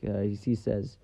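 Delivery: ordinary talk, nothing marked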